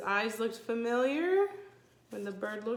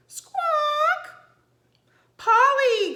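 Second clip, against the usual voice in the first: high, loud squawking bird; parrot squawk; 12 dB louder, much slower than usual. Acted. Narrator squawks as the parrot arriving.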